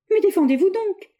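very high, loud plea; a hurt fiancée crying out at Roger's silence; desperate cry. A narrator's reading. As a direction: cry out for him to defend himself. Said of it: "s'écria-t-elle".

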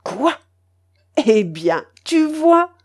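rising, gleeful tu vois; triumphant. A narrator's reading. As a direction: crow in victory. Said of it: "avec une indescriptible expression de triomphe".

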